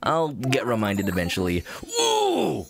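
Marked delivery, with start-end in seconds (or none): 2.0-2.6 high, hooting, excited curator voice; animated stuffy scholar, pitch starting very high; Blathers, delighted. Acted. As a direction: hoot with delight, then settle into formal praise. Voices the museum character's dialogue.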